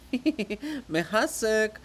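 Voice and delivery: loud laughing jeer; teasing with a laugh. Acted. Chorus teasing loudly.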